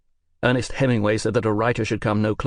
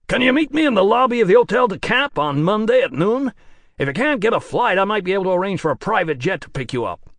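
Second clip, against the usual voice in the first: American producer voice; 9 semitones higher, 5 dB louder than usual. Acted. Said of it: "work a lot harder on that American accent".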